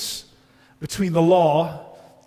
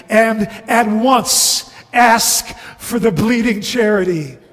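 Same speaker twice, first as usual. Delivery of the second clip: louder, higher, driving sermon delivery; fervent preaching; 5 semitones higher, 6 dB louder than usual. Real, not acted. Preacher urging a friend facing the law, fervent. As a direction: press the appeal on the listeners.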